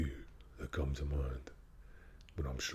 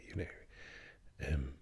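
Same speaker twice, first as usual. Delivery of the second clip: normal talking voice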